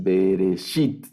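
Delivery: Bereshit slow, stress on the final -shit; acento en la última. Shown as a demonstration. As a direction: draw out Bereshit, landing on its last syllable. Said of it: "aquí va el acento". (Bereshit lento, con el acento en la última sílaba)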